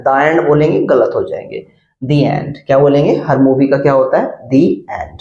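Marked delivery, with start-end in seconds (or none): 2.0-2.6 दी एंड with दी as "thee"; "thee" before vowel. Shown as a demonstration. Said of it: "यहाँ पे आपको दी एंड बोलना है".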